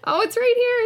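high excited exclamation; excited find. Real, not acted. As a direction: pitch it up with delight. Finds the name, excited.

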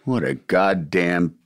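low, soft mock contempt; mock disgust. Teasing his friend for quitting, mock disgust. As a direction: groan it in fake scorn.

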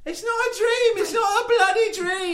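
high loud frantic protest; panicked insistence. Acted. Philippe insisting it's real, high loud panic. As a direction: shout it in rising panic.

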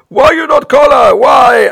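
put-on high, loud Italian-accented yell; shouting Italian host. Host yelling at Bob on live TV, Italian-accented shouting. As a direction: bellow the question at him in an Italian accent. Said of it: "And he says".

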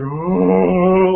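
long drawn-out "Oh"; hammed-up blissful "oh"; mock reveling. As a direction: act out basking in it with one held moan. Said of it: "like, reveling in it".

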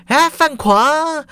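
高声拉长地喊名字; 在背后故意拖长声调叫他; 拖长叫名. A narrator's reading. 校长在后面叫他. (校长从背后拉长声音喊范狂)

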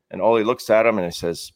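normal talking voice

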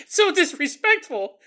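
mock outrage. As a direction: protest as a comically offended demon. Acts offended shadow demon, repeating.

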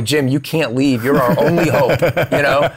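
high, loud, half-laughing mock begging; laughing plea. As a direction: beg Jim to stay through laughter. Pleading with Jim, laughing.

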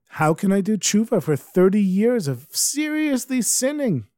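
acted disbelief, climbing high; mildly hammed-up, high-pitched incredulous question; incredulous protest. Fivish doubts the Baal Shem Tov's remedy, high and incredulous.

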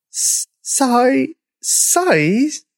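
halting, high-pitched try at a word; tentative attempt. A narrator's reading. Mother dinosaur trying a hard name, tentative. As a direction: stammer through an unfamiliar name, questioning. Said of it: "tried Brenda".

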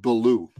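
"Balou" said as buh-LOO; correct surname pronunciation. Shown as a demonstration. Guest correcting host on his surname, "Balou" as buh-LOO. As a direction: stress the second syllable of "Balou", buh-LOO. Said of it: "my last name, pronounce it".

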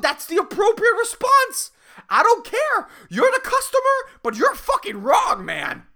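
high, exasperated comic protest; indignant rant. Acted. Barista defends his weird face to customer, indignant. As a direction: insist indignantly, pitch way up.

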